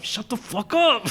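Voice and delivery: high, drawn-out annoyed retort; mock exasperation. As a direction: imitate an exasperated moviegoer, slow and high. Imitates annoyed serious-horror moviegoer.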